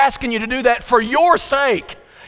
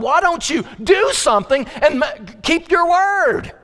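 raised, pleading cry to God; anguished plea; 9 semitones higher than usual. Retold or imitated. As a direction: demand that God act, slowing on the last words. Victim crying out to God.

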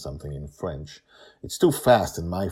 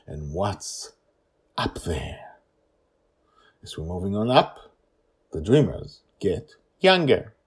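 plain reading voice

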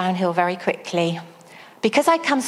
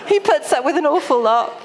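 laughing; 5 semitones higher, 4 dB louder, faster than usual. Real, not acted. Laughing at herself about Steve.

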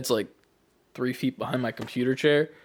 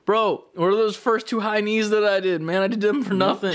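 higher-pitched, half-laughing complaint; indignant protest; 7 semitones higher than usual. Retold or imitated. Player argues with the game over uncounted reps, mock indignant.

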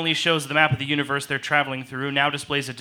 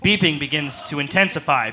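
ordinary talk

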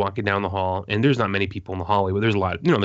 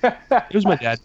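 much higher, louder mock claim; joking deadpan boast; 10 semitones higher, 5 dB louder, slower than usual. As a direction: play it up as a cheeky joke. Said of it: "And then I go".

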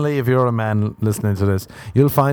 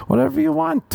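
sing-song. Real, not acted.

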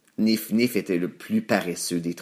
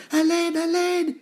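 very high squealing piglets; panicked cry. A narrator's reading. Two pigs fleeing to their brother, panicked squeal. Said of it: "crièrent-ils".

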